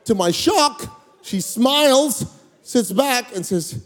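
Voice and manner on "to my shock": ordinary delivery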